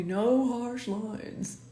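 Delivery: slow, drawn-out catchphrase for viewers to repeat; slow mantra. Shown as a demonstration. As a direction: spell out no harsh lines slowly like a motto. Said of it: "'cause say it with me".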